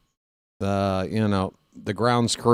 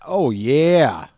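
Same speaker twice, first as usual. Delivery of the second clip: light Kool-Aid Man; 2 semitones higher than usual. Retold or imitated. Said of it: "My Kool-Aid Man impression".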